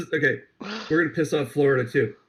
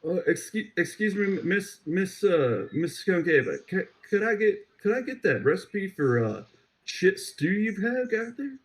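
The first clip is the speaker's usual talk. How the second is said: put-on southern dandy accent; 4 semitones higher than usual. Podcaster tries a Southern Dandy accent on his co-host, mild and higher. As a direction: play a genteel Southern gentleman asking a lady politely. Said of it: "I'm going for Southern Dandy".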